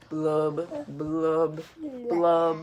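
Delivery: low, slow glum fish blub. Acted. Reading to a child, the sulky fish goes low on blub. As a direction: drop the voice low and let each blub sink.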